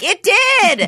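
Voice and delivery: high, loud mock enthusiasm; over-eager agreement. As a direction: jump in with exaggerated reassurance. Imitates friends' over-eager agreement.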